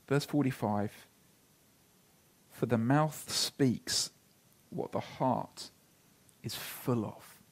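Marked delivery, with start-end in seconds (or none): 2.5-7.4 slow, soft scripture reading. Acted. Preacher reading the verse to the congregation, slow and hushed. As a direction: let each phrase land slowly.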